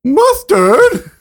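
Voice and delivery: high, loud mock British accent; sudden high accented yelp; accented outburst. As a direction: burst out with it in a shrieky British accent. Echoes the trigger outburst.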